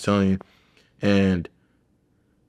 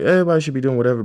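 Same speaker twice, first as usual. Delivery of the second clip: eager younger self; 6 semitones higher, 3 dB louder, much faster than usual. Retold or imitated. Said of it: "I was just like".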